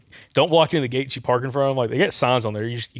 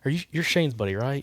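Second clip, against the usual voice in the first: whispering; 3 semitones higher, 5 dB quieter than usual. Retold or imitated. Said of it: "I started whispering too".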